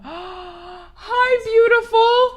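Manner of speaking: gasp, then a high, loud squeal; delighted greeting. Real, not acted. Gasping greeting to an arriving raider.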